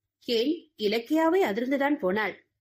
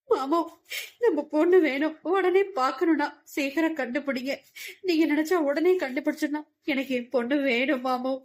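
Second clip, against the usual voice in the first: wailing plea; 4 semitones higher than usual. Acted. A wife wailing to her husband for their lost daughter. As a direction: cry out to him for your daughter. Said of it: "கதறினாள்".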